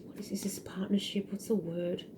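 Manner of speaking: very soft, half-whispered aside; hushed self-questioning; muttering to herself. Real, not acted. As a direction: think aloud under your breath while searching for a word. Searching for a word to herself.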